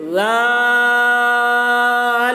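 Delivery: زال stretched very long with a thin sound; long held letter name. Shown as a demonstration. Teacher demonstrates زال for students, stretched and chanted. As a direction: chant زال slowly, tongue tip out. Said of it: "اس طریقے سے".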